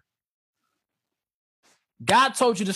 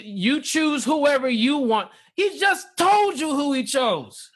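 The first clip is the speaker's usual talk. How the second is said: exasperated; 6 semitones higher, slower than usual. Real, not acted. Mocking Moses then exasperated.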